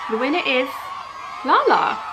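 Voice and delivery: loud, high winner reveal; hyped giveaway announcement. Real, not acted. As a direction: shout out the winner's name.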